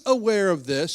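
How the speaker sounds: usual speaking voice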